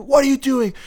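put-on sharp high yell at a little kid; dad snapping at his son; angry dad yell. Dad yelling at his kid over the bat, sharp angry yell.

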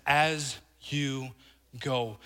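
low, soft, spaced word by word; hushed quoting. Retold or imitated. Preacher savoring the words of Jesus, hushed. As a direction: drop low and let each word sit.

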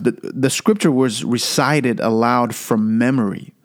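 ordinary delivery

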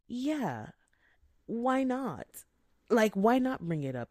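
mock indignant why not; defiant retort. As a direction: push back hard.

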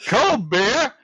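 high, loud ballpark beer call; stadium vendor hawking. Shown as a demonstration. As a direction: hawk it to the stands. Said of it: "I said the same thing every time the same way and see if I can recreate it".

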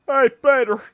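loud, high mock greeting to Peter; mock fan shout. Fan shouting at Peter, loud mock greeting. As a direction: call out to him like an eager fan. Fans impersonating Peter Griffin.

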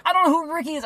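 high-pitched exasperated retort; retold exasperation. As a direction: act out being fed up with a robot. Acted frustration at the robot.

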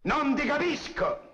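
high, slow barked shout; high slow mock bandit yelling at prisoner; bandit's shout. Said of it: "diceva il bandito a me".

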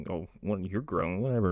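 soft, low, half-hearted reply; mock meek mumble. Kano answering Shang Tsung's insult, meek quiet mumble. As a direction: trail it out quietly, giving in. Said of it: "He's like".

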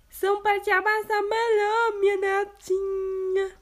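wolf disguising voice. Acted. Wolf posing as grandma, disguised voice. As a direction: pass yourself off as grandma.

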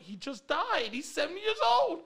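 mock exasperation. Prankster explains Sam's death to the caller, exasperated. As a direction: act out an exasperated answer, climbing in pitch.

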